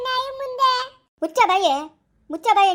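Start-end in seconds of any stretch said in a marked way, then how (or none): 1.2-1.7 angry shout. Acted. Woman in a quarrel shouting angrily at her rival. 2.3-2.7 angry shout. Acted. Woman in a quarrel shouting angrily at her rival again.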